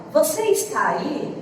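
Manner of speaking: "Você está aí?" with a rising question tune at the end; question intonation. Shown as a demonstration. Teacher showing students how Portuguese marks a question by tune alone. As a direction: let the voice climb on "aí" so it's clearly asking. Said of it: "a entonação da minha voz permite saber que é uma pergunta".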